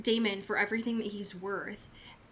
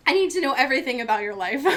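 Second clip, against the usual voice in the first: laughing; 3 semitones higher, 7 dB louder, much faster than usual. Retold or imitated. Eager laughing quote.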